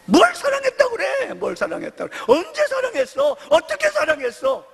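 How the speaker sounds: hammed-up sharp wife scoffing back; shrewish wife. Wife scoffing at husband's love, shrill high mockery. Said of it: "이 예편네가".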